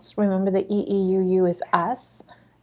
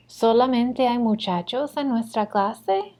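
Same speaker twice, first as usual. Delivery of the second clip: practice question, lifting at the end of "clase"; rising question intonation; 3 semitones higher than usual. Shown as a demonstration. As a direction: ask it with the tune climbing on "clase". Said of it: "let's practice reading these".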